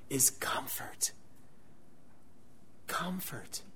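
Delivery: soft unvoiced whisper; whispered comfort. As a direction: let the word fall to a whisper. The key word of the promise.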